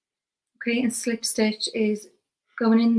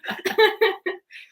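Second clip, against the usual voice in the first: laughing; 10 semitones higher than usual. Real, not acted. Laughing after "we peaked.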